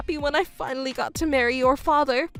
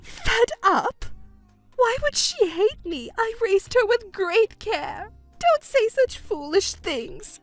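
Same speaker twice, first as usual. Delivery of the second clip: high-pitched, offended protest; indignant disbelief; 9 semitones higher than usual. Acted. Mother told her daughter is fed up, indignant. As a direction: refuse to believe it, outraged.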